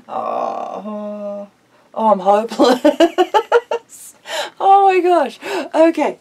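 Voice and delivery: loud, laughing through the words; helpless laughter. Real, not acted. Woman cracking up at her messy paint roller, laughing helplessly. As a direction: laugh until you can barely speak.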